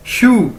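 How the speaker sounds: शू said like English "shoe"; pronunciation demo. Shown as a demonstration. Teacher likens the Chinese word to shoe, pronunciation demo. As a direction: model शू as one isolated falling syllable. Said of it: "एक जूते को कैसे बोलते हैं".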